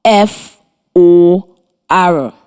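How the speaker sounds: letters F O R spaced slowly; slow spelling dictation; spelling aloud. Shown as a demonstration. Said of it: "it is spelled".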